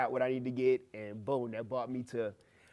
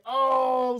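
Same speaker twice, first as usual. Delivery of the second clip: high, loud shout of surprise; startled shout; 10 semitones higher, 8 dB louder than usual. Retold or imitated. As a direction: cry out while running. Running off stage in shock.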